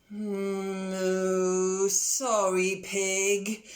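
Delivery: sorry cow, owning the mistake; contritely. Acted. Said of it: "said Cow contritely".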